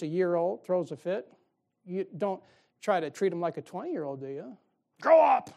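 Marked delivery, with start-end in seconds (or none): 5.0-5.5 put-on loud, high shout at a toddler; mock yell. Fed-up parent yelling at a one-year-old, loud shout.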